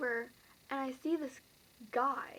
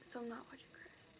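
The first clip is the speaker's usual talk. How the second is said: whispered aside; 12 dB quieter, faster than usual. Real, not acted. Aside while filming.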